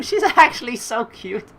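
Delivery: high delighted giggle; giggling. Real, not acted. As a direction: gush with laughter. Giggling at the scene.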